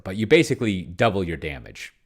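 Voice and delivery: normal talking voice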